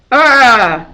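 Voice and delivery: loud, high cry of rage; pained monster yell. A narrator's reading. Medusa struck by Grover, yelling in rage. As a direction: let out a stung shriek. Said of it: "Medusa yelled".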